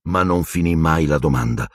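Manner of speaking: plain reading voice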